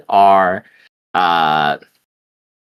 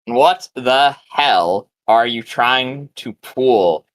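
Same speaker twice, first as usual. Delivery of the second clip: slow, halting, word-by-word rebuke; lightly voiced gruff woman, spacing out each phrase; gruff woman's voice; much slower than usual. Stone's reply scolding Zargan, slow and lightly gruff. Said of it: "very gruff woman's voice".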